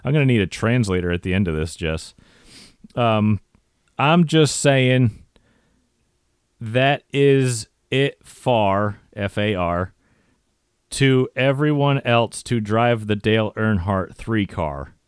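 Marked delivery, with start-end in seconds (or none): 4.0-14.9 slow halting read-through. Shown as a demonstration. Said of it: "no, wait, I can't even read".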